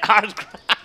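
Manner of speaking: loud, laughing self-mockery. Real, not acted. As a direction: laugh through the words. Laughing at own wrong guess.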